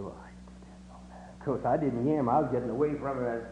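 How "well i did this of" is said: put-on frightened boy's whisper, barely audible. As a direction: breathe it out in a scared whisper. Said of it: "the boy said".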